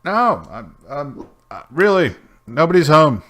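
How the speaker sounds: high, loud, stumbling denial; hammed-up voice of someone hiding indoors; flustered occupant. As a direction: call out a feeble excuse through the door. Occupant pretends nobody is home.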